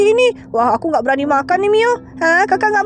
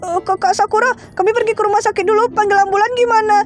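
usual speaking voice

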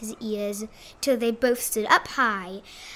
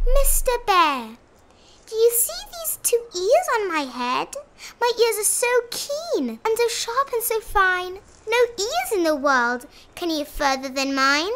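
high-pitched, self-satisfied rabbit voice; boastful rabbit; 9 semitones higher, 4 dB louder than usual. A narrator's reading. Rabbit bragging to the bear about his ears, high and proud. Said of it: "he boasted out loud".

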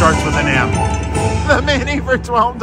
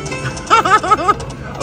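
high giggle. Real, not acted. Laughs at the slot.

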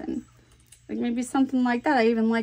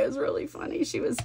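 ordinary talk, nothing marked

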